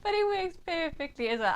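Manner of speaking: normal talking voice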